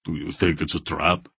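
rumbling, wary question; a muted rumble of suspicion; rumbled. A narrator's reading. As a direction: rumble it under the breath. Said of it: "Barak rumbled".

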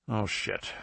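a soft, dismayed breath of a curse. A narrator's reading. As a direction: let it out barely voiced, dismayed. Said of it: "Bello breathed".

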